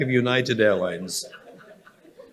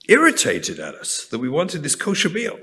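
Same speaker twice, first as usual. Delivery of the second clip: ordinary delivery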